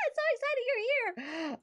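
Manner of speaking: very high excited squeal; squealing excitement. Real, not acted. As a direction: gush over a friend who just arrived. Greeting a viewer who joined.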